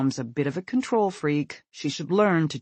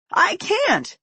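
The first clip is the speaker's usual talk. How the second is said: much higher, loud desperate refusal; 7 dB louder than usual. Acted. Panicked son refuses his mother, high and desperate. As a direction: plead out of panic. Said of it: "he says desperately".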